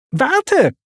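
hoher, lauter Ruf; Timons Schrei. A narrator's reading. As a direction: ruft ihm nach, er soll bleiben. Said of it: "schrie Timon". (Timon ruft Pumba hoch und laut nach, er soll warten)

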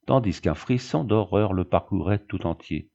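ordinary delivery, nothing marked

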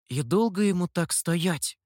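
plain reading voice

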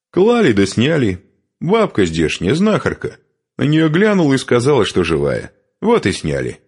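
high, lively explanation; cheerful. A narrator's reading. Newcomer explaining how she survived, cheerful. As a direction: chatter it out gaily, settling at the end. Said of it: "раздался весёлый голос".

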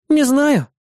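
put-on high bright girlish voice; young woman voice. A narrator's reading. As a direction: voice the demon girl cheerfully. Irda shrugging.

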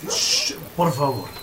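soft urgent shh; hushing. Acted. Belgrano hushing the visitors, soft and urgent. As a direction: keep everyone quiet.

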